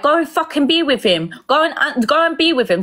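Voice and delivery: high-pitched imitation of an angry partner; retold jealous rant. Angry partner ordering her to go be with him, retold jealous rant.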